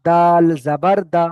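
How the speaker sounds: দাল জাবার দা, slow and loud, দা kept short; short Arabic reading. Shown as a demonstration. Teacher to online class, loud slow recitation. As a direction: say it slowly but keep দা short. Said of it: "এটা হারাকাতে পড়া".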